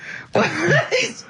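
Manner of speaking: a high burst of real laughter; real high-pitched laugh. Real, not acted. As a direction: cackle with delight at the joke. Laughs at his own joke.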